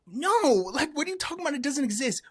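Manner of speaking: high, loud mock denial; dismissive denial. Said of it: "they'll say".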